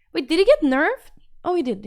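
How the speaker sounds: normal talking voice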